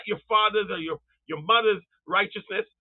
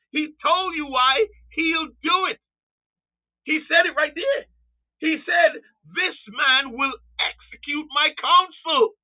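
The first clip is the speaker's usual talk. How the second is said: high, raised preacher's cry; impassioned preaching; 6 semitones higher, 3 dB louder than usual. Real, not acted. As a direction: drive the point home at a high pitch. Preacher climaxes his argument.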